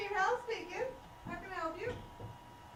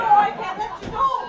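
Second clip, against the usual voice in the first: loud, slow, higher protest; urgent refusal; 4 semitones higher, 16 dB louder, slower than usual. Acted. Waitress blocking the raging man, urgent and loud. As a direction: plant yourself and shout it.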